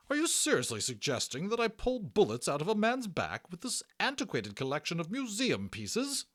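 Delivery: high, loud, outraged shriek of a prim nurse; shrieking matron. Acted. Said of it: "she almost shrieked".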